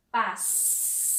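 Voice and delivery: "Passeio" whispered, the S hissed; whispered demo word. Shown as a demonstration. Teacher whispering "Passeio" to kids to show the S. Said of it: "quero que tenha o som de S".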